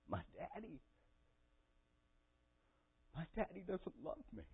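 put-on small, high, soft hurt-child plea; high, wounded voice from a retold breakdown; retold tearful confession. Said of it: "I just sobbed and cried".

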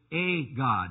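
"a" said as a long "ay", punched before God; stress on "a". Shown as a demonstration. Preacher showing the Witnesses' wording, stress on "a". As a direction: hit "a" hard as its own word. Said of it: "the emphasis is on a God".

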